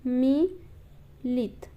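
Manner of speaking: मिलित drawn out very slowly, long ई; slow demonstration. Shown as a demonstration. Teacher modelling the spelling for students, very slow. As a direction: stretch मिलित, lean on the long ई. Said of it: "तो यहाँ पे मिलित".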